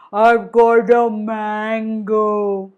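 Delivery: slow, drawn-out boast; proud crocodile. Acted. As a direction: savour every word of the news.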